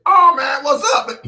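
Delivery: high, loud friendly whoop. Retold or imitated. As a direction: squeal it like an excited buddy. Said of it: "His voice went way up".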